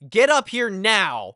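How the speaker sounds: loud, high, slow mock command; mom's summoning shout. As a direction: imitate a mom ordering her kid upstairs. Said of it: "my mom calls from upstairs".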